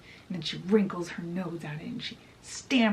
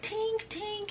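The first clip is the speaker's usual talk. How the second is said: high, hushed pretend holler; mock yell; 13 semitones higher, 7 dB quieter than usual. Peter calls his fairy over, high soft mock yell. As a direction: call her in a high, soft voice. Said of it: "yells out to her".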